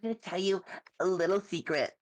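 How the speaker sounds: high ditzy airhead voice; quirky bimbo, high and bubbly. Pretend. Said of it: "love doing my bimbo voice".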